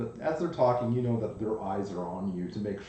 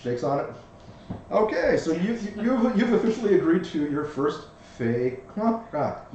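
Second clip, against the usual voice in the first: louder, higher tiny bug voice; pixie; 4 semitones higher, 7 dB louder than usual. Acted. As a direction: seal the deal eagerly as the bug. GM voices the ladybug.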